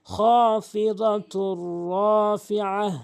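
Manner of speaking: slow, drawn-out chanted recitation; slow reading. Shown as a demonstration. Teacher showing how slowly to read, slow chant.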